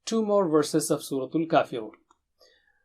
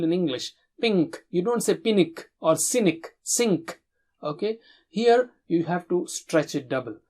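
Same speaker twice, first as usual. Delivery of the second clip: normal talking voice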